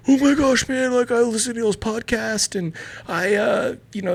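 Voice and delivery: high-pitched, gushing imitation; starstruck fan. Stranger recognising a podcast host, imitated starstruck gushing.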